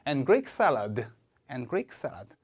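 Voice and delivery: "and Greek salad" falls at the end; falling final item. Shown as a demonstration. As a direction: let "and Greek salad" drop to close the list. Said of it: "제가 읽으면 따라해 보실게요".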